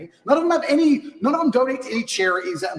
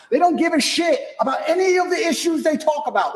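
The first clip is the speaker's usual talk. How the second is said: a raised, heated outburst; angry shout; 9 semitones higher than usual. Real, not acted. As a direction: blast it out at the critics. Ranting about critics' fake virtue.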